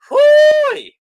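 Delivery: very high, loud drawn-out "hooey"; excited whoop. Acted. Fan reliving the electric cinema crowd, whooping. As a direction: let out a piercing whoop.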